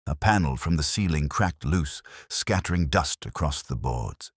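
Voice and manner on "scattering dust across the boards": ordinary delivery, nothing marked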